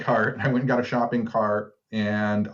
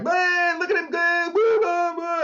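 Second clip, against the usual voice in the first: much higher, whooping mockery; mocking jeering crowd. Bystanders laughing at a spinning pigeon, mock whooping in a high voice. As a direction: imitate cruel onlookers cheering a hurt bird. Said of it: "people were like".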